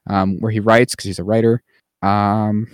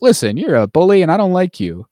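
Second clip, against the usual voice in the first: lightly higher mock heroic prime minister; mock Hugh Grant speech; 6 semitones higher than usual. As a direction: play the defiant British PM, half-teasing. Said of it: "he's like".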